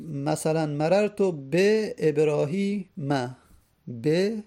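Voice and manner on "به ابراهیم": ابراهیم slowly drawn out, ending in "-ma"; fatha ending. Shown as a demonstration. Teacher giving an example of genitive, slow fatha ending. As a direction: say ابراهیم slowly with a clear final a after the m.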